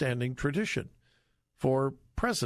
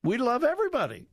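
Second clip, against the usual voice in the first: high, fast, sing-song parody; mocking liberal mantra; 8 semitones higher, faster than usual. Host mocking the liberal left's mantra in a sing-song. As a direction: chirp it like a smug slogan. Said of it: "the mantra of the liberal left".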